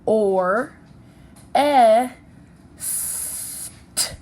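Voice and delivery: "or, e, st" as isolated sounds, pauses between; tapped out by sounds. Shown as a demonstration. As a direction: sound out "or, e, st": loud short eh, hushed hissed st. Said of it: "let's tap that out".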